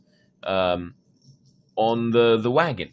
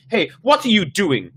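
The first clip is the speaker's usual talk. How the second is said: high, loud angry demand; a mother's furious challenge; 11 semitones higher, 9 dB louder than usual. Acted. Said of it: "her voice shrill and angry".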